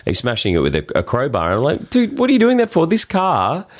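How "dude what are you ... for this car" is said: high, incredulous scolding; mock outrage at a stranger. Telling off the guy smashing his car, high mock outrage. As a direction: pitch it way up, appalled at him.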